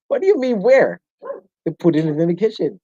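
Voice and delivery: ordinary talk, nothing marked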